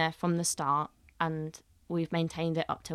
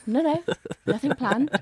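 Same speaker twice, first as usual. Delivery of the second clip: higher, louder innocent denial; mock innocent chef; 8 semitones higher, 6 dB louder than usual. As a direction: play the chef covering a secret.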